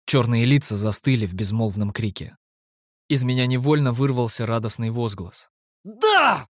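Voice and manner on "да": laughing exclaim. A narrator's reading. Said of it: "со смехом в голосе воскликнул я".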